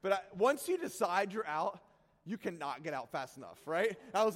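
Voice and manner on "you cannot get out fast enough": normal talking voice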